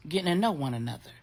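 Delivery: usual speaking voice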